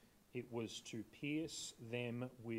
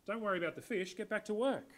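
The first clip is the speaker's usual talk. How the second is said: higher and louder, a cheery hey guys; breezy call; 8 semitones higher, 4 dB louder than usual. Pretend. Pretend Jesus breezily greeting fishermen in boat, bright and higher. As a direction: toss it off like a mate turning up at work. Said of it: "He could have just turned up and said".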